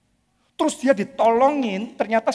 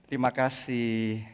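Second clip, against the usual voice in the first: quiet, lower thank-you; soft thanks; 10 semitones lower, 9 dB quieter than usual. Retold or imitated. Juan thanking the guard quietly. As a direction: thank him gently. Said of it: "dia masuk".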